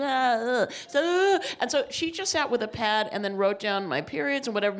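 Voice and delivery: ordinary talk, nothing marked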